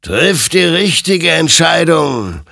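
high, loud, drawn-out warning; otherworldly being urging a choice; stern admonition. A narrator's reading. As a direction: admonish slowly and forcefully. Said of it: "ermahnte ihn sein Gegenüber".

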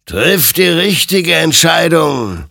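slow, high-pitched exhortation; stern admonition. A narrator's reading. Apparition warns the soldier to choose rightly, admonishing. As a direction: admonish him, stretching each word. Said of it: "ermahnte ihn sein Gegenüber".

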